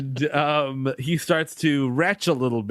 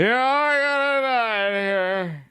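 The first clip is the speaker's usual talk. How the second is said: put-on higher, slow drunken wail; 6 semitones higher, slower than usual. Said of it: "he must be so drunk".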